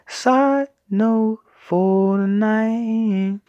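very slow, drawn-out announcement; slow solemn proclamation. Shown as a demonstration. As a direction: stretch every word like a solemn decree.

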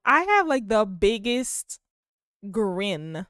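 usual speaking voice

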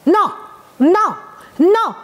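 rising, repeated mock no's; a nagging mom saying no all day; nagging mother. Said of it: "mamás diciendo que no".

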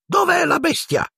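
piercingly high committee member; shrill demand. A narrator's reading. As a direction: demand an answer in a very high, ringing voice. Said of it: "la voce squillante del membro del comitato". (voce squillante, acutissima)

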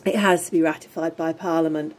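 ordinary talk

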